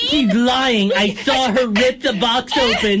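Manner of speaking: high tattling voice. Pretend. Playing tattling customer.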